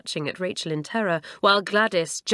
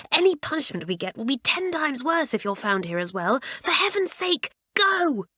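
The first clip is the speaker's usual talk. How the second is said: urgent; 7 semitones higher than usual. A narrator's reading. Pushing her out the window.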